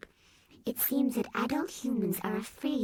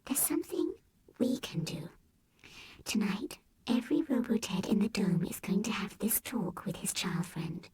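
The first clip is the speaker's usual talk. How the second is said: whispered robot teddy; soft whisper; 3 semitones higher than usual. A narrator's reading. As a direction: share a secret plan quietly. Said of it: "in his softest voice".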